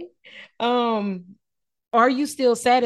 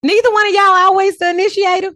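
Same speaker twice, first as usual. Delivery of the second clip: high, loud incredulous question; astonished, raised-voice challenge; loud disbelief; 12 semitones higher, 10 dB louder than usual. Real, not acted. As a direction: call them both out, voice shooting up. Host reacts to both saying no.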